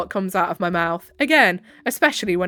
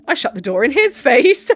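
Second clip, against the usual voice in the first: louder and higher, cracking up; laughing; 4 semitones higher, 6 dB louder than usual. Real, not acted. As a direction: laugh breaking into the last word. Laughing while reading the story.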